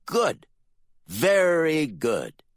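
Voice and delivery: high, loud, drawn-out praise; commanding leader, relishing it; loud approval. A narrator's reading. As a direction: call back approval, stretching it slowly. Leader calls back to followers.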